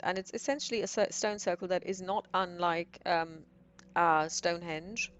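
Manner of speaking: ordinary delivery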